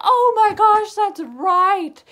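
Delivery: distraught fan yelling at the book; ranting outcry. Retold or imitated. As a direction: wail it as if a favourite just died. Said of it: "I was just like".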